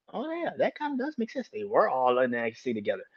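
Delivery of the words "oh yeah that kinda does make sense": mock realisation. Mocking a viewer's realisation.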